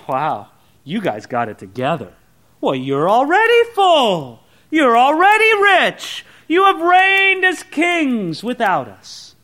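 slow, high mocking praise; sarcastic. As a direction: drawl each "already" with sarcasm. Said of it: "a real note of sarcasm".

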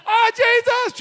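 high, loud panicked yelp; frightened cry. Acted. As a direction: yelp like you're dropping through a roof. Man lowered through the roof.

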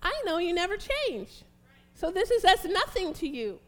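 loud, high preaching prayer; rising prayer at full force; fervent prayer. Real, not acted. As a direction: cry out to the Lord in praise. Preacher prays aloud to God.

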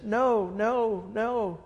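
lightly acted wail, not real crying; mock crying. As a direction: imitate a grandma's tearful protest. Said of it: "she starts crying and says".

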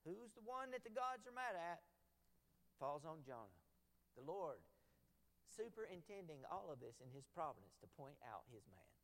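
normal talking voice

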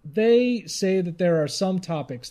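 normal talking voice

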